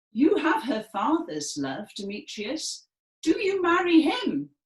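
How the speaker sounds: rising to a high loud sneer; furious Lysander. Shown as a demonstration. Lysander taunting Demetrius in front of the class, furious. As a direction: taunt him with it. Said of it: "He says".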